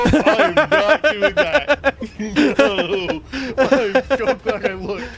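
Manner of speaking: laughing hard, high and loud. Real, not acted. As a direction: laugh through the words in disbelief. Cracking up at the soup.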